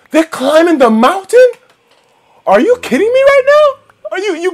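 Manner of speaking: high, loud, slow disbelief; outraged shout. Real, not acted. Player shouts at zombies climbing his mountain, outraged shout. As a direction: howl the disbelief.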